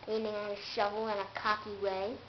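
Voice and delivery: ordinary delivery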